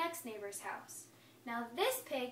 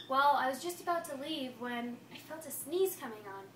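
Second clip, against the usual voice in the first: ordinary delivery, nothing marked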